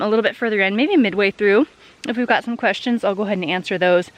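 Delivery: ordinary talk, nothing marked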